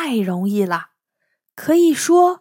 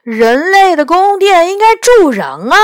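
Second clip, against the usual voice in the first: high, loud, protesting retort; acted indignant rebuttal; unconvinced; 8 semitones higher, 8 dB louder than usual. A narrator's reading. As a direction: object loudly, refusing to give in. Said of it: "第二个声音不服气地说".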